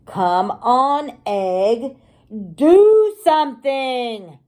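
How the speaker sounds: loud, slowing impatient shout. Acted. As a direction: yell at the egg to hurry.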